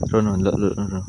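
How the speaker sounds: loud, urgent come look; loud urging. Real, not acted. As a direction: push him to look now. (bengok ngajak ndelok)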